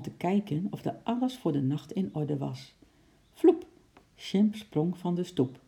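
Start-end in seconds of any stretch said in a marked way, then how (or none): 3.3-3.7 quick, high, loud leap noise; imitated whoosh. A narrator's reading. Narrator voices the ape hopping off the step, high whoosh. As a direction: pop it out like a sudden jump.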